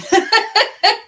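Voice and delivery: loud, high burst of laughter. Real, not acted. Host laughing at her viewer count, loud laugh.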